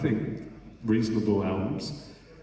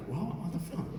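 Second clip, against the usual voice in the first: shocked disbelief; 8 semitones higher, 8 dB quieter than usual. Retold or imitated. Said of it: "I'm like".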